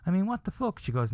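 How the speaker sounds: ordinary talk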